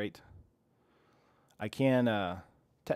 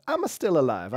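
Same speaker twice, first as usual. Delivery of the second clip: a lighter mock Italian accent, a bit higher; playful Italian accent on the repeat; 5 semitones higher, 6 dB louder than usual. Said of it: "I said it like an Italian there".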